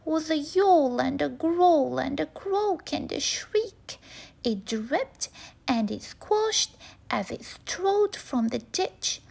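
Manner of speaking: ordinary delivery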